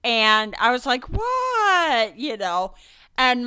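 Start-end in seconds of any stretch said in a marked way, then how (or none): 1.0-2.2 high, drawn-out innocent "What?"; mock-innocent surprise, pitched up; feigned surprise. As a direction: act caught off guard. Mom feigning innocence when caught.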